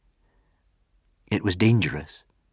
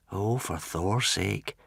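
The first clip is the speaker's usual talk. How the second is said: quiet dismay; 9 dB quieter, slower than usual. Acted. Fishlegs mutters in dismay.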